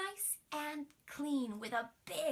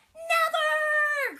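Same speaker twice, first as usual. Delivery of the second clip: high, loud cry of refusal; shouted; 14 dB louder than usual. Acted. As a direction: scream it like a tantrum. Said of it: "cried the princess".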